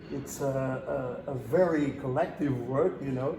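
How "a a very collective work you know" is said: normal talking voice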